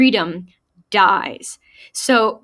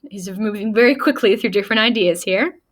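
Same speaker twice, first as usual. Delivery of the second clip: ordinary delivery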